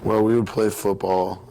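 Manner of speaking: ordinary delivery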